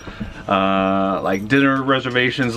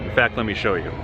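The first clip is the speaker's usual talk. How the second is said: usual speaking voice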